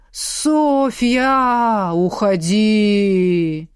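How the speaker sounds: slow, drawn-out moaning wail; ghostly wail. Acted. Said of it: "Затянули снова".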